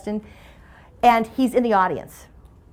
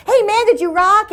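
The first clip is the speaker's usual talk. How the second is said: higher, louder, hyped-up answer; mock buddy-buddy excitement; eager phone greeting; 10 semitones higher, 5 dB louder than usual. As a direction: imitate an enthusiastic pickup of the call. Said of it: "Now I answer like this".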